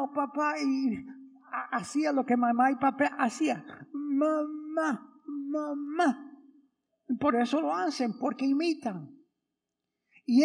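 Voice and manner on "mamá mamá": put-on baby calling mama.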